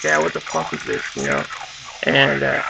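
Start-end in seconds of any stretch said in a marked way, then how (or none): 0.0-1.4 hushed, voiceless whisper, lower; whispered kid. Retold or imitated. Kid whispers to his dad about the tape, awed. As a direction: breathe it out like a stunned kid. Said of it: "so I was like".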